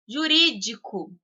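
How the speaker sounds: Jurídico with RÍ held and stronger; stress on second syllable. Shown as a demonstration. Teacher giving a proparoxytone example, stress on RÍ in Jurídico. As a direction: lean on the -rí- of Jurídico. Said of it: "o ri é mais forte".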